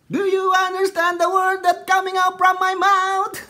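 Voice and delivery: high, loud, slow movie-quote yell; Rush Hour movie line. Retold or imitated. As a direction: belt it out like the cop in Rush Hour. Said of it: "Alam niyo yung sa Rush Hour". (mataas at malakas na paggaya sa linya sa pelikula)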